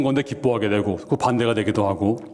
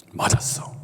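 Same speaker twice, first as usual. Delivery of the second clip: whispered agreement; 3 dB quieter than usual. Acted. God agrees with Jonah.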